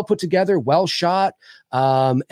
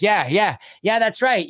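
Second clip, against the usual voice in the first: higher, eager mock-kid agreement; hammed-up boyish enthusiasm; ten-year-old in a chair; 8 semitones higher, slower than usual. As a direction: imitate an excitable kid chiming in. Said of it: "like a ten-year-old sitting in the chair".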